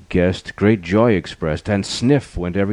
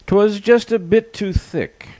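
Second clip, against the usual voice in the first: very high, put-on character voice; sustained high-pitched comic reply; lion's reply; 14 semitones higher than usual. A narrator's reading. Lion excusing the eaten soup, very high comic voice. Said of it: "Leo replied".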